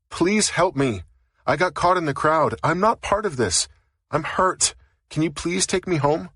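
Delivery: high, desperate plea to a police officer; woman caught in a riot begging to be let go; pleading young woman. Acted. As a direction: beg for help, voice pitched high.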